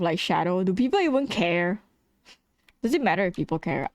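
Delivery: ordinary talk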